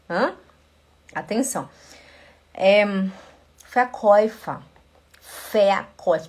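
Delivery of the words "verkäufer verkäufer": Verkäufer with an F sound, fair-koy-fer. Shown as a demonstration. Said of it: "Tudo que tiver V no alemão, ele faz som de F".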